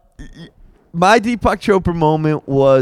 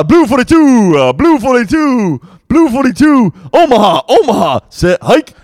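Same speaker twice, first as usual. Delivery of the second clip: quarterback calling cadence; 5 semitones higher than usual. Acted. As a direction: bark out the play at the line. Quarterback calls the snap.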